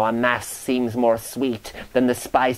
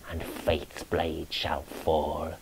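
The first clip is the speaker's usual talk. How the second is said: softer, slower doom line; grim hushed prophecy; 6 semitones lower, 10 dB quieter, slower than usual. A narrator's reading. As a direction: let the doom sink, softer.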